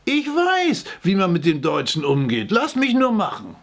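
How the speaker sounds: high-pitched, insistent self-assertion; cocksure boast. A narrator's reading. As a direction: insist that you are the expert. Boasting in an acted squabble. (rechthaberisch, mit hoher Stimme)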